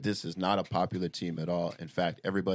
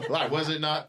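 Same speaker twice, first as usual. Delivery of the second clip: higher, amused, laughter in the words; laughing; 7 semitones higher than usual. Real, not acted. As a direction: say it through a laugh, pitched high.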